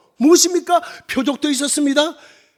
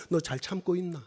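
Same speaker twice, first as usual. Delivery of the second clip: sudden drop to low and soft; God's hushed question; 8 semitones lower, 13 dB quieter than usual. Retold or imitated. God asks the missionary if he is enduring, hushed question. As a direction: ask it very quietly, in dialect. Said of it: "하나님 정작 저에게 물어보시는 것은".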